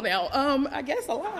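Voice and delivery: normal talking voice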